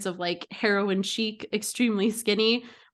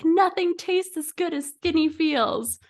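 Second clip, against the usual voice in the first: high mock battle cry; imitated yell, pitched up but not truly shouted; mock scream; 7 semitones higher than usual. Almond moms in final epic battle, mock screaming. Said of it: "screaming back and forth at each".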